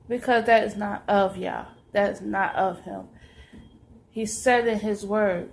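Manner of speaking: normal talking voice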